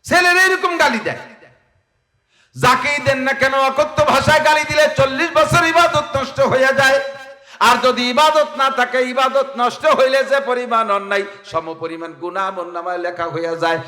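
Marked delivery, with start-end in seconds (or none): 0.0-11.2 high, loud impassioned sermon cry; full-force preaching. Acted. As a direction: preach it out to the crowd, voice raised high. (উচ্চ স্বরে জোরালো ওয়াজ)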